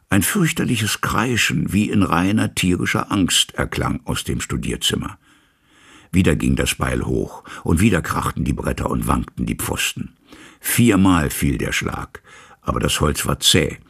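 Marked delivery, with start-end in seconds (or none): none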